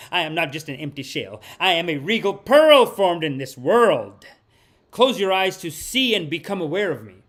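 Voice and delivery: intoning the card's words to the viewers; solemn card reading. Acted. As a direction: read it like a sacred message from beyond. Rereads the oracle card.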